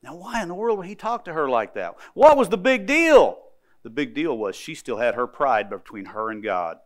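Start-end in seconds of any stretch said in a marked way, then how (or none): 2.1-3.4 emphatic question. Real, not acted. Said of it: "rhetorical question to congregation".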